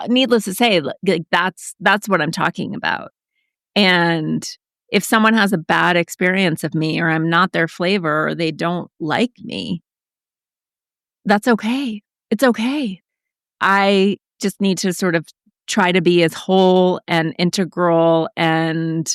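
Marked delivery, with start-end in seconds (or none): none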